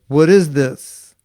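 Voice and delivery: slow, loud, stern demand; stern question. Retold or imitated. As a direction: ask slowly like a displeased master. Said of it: "And Prabhupada said".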